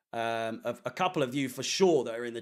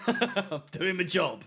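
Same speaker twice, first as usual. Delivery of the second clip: laughing; 3 semitones higher, slower than usual. Real, not acted. Streamer laughing at his own joke to chat. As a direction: chuckle through it, slowed down. Joking about not attending games.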